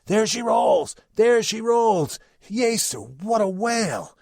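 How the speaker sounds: high, loud sailor's cry from the chains; sudden loud lookout shout; excited shout. Acted. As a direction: yell it out at first sight of the whale. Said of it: "all at once shouted out".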